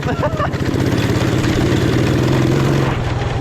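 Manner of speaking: big burst of laughter; loud laugh. Real, not acted. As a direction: laugh out hard at the mishap. Bike lid flicks off, laughs.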